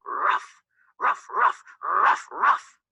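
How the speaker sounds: loud yapping barks, one squeaking high; barking dog. Acted. Dog answers Middle Chick, loud barking. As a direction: bark hard at the little threat. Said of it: "Dog disagreed, barking".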